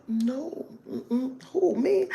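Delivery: high, hushed mock innocence; prissy denial. Mocking a prim woman, high prissy no. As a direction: squeak out a prim refusal. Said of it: "some people think".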